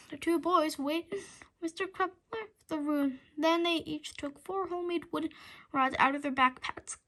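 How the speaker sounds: ordinary delivery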